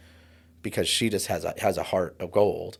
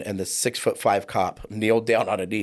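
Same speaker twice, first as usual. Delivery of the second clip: normal talking voice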